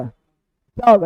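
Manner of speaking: usual speaking voice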